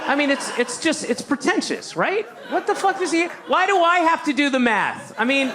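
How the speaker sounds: high whiny heckler griping; mock heckler rant. Heckler griping about Lincoln's math, high and whiny. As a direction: whine like an annoyed bro.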